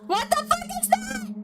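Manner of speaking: very high-pitched squeal of alarm; freaked-out falsetto squeal; startled shriek. Real, not acted. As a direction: shriek it, spooked by something creepy. Startled by sound in game.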